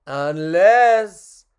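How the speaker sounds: loud, rising, stretched "Unless"; dramatic build. Acted. Clown teasing the puppet with a chance, loud and drawn out. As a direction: swell "Unless" for suspense. Second, heightened take.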